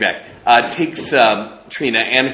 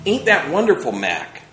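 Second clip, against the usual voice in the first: higher, louder, thrilled question to Mac; delighted; 7 semitones higher, 3 dB louder than usual. Acted. Trina thrilled at the kinetoscope, asking Mac, delighted. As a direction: gush with delight.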